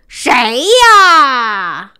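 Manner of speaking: loud shout from bed, fed up. Acted. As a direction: yell "who is it" at the door. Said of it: "大叫道". (不耐烦地大喊)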